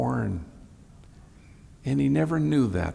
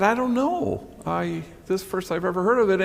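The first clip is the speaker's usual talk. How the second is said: feigned innocence; 8 semitones higher than usual. Retold or imitated.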